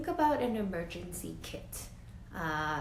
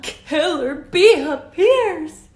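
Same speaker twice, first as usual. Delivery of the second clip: slow, much louder and higher alarm call; dramatic announcement; 8 semitones higher, 19 dB louder, slower than usual. A narrator's reading. Teacher announcing the bee to her class, dramatic and loud. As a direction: sound the alarm for the listening kids.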